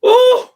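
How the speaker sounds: sudden high, loud cry of emotion; emotional ooh. Real, not acted. Thanking a brother, voice breaks into a high ooh. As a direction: let out a choked-up high ooh. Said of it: "boy, I'm about to cry".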